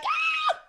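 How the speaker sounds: very high, loud comic scream; re-enacted scream. Friend re-enacts screaming on turbulent flight, loud high shriek. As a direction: let out a piercing mock scream. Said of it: "screaming on that flight".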